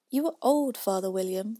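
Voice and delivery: loud, sing-song recital; poem recitation. A narrator's reading. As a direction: recite it like a schoolgirl.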